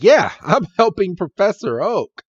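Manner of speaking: high, perky answer; eager Red. Acted. Red to Mister Fuji, eager. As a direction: answer brightly with pitch leaping up.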